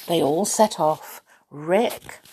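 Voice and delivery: plain reading voice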